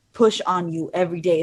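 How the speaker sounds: ordinary talk, nothing marked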